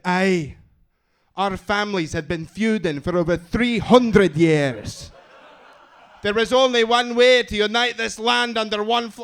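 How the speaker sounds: a booming Scottish lord proclaiming a feud; Scottish accent. Acted. Answering the arranged-marriage question in a booming Scottish accent. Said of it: "I love the Scottish accent".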